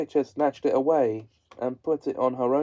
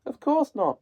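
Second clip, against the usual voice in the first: high, innocent reply; girl answering; 12 semitones higher than usual. A narrator's reading. Dorothy answers the witch, high and innocent. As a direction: answer simply, a little girl. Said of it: "answered Dorothy".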